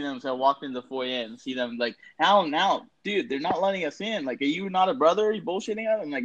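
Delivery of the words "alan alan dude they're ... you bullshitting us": high, pleading friend at the door; panicked friend. Retold or imitated. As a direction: beg like a stranded college buddy.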